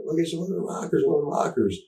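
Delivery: a lightly voiced third grader spotting wrestlers; excited kid. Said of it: "He was like".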